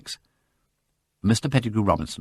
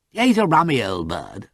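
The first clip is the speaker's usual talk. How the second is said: tactless remark; 7 semitones higher, 3 dB louder than usual. A narrator's reading. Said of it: "said the Honourable Freddy tactlessly".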